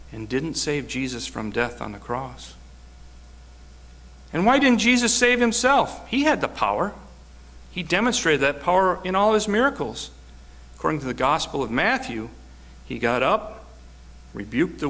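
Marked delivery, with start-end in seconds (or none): 4.3-10.1 preaching at full force. Acted. Preaching climax.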